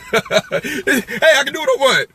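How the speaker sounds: cheeky laughing shrug; laughing defiance. Real, not acted. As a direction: toss it off with a laugh.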